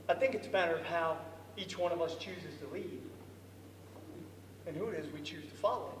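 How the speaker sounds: storyteller pausing to reflect quietly; sincere reflection. Real, not acted. Reflective moral aside.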